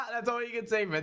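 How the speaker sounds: words pushed out high through laughter; laughing. Real, not acted. Vlogger to viewers about the old chart, laughing. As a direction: laugh the line out, still cracking up.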